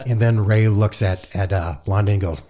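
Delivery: ordinary talk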